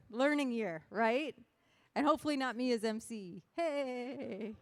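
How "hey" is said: put-on drawn-out, playful cowboy accent. MC joking with the convention crowd, cowboy accent. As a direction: put on a cowboy twang, stretching the words. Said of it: "Who liked my cowboy accent?".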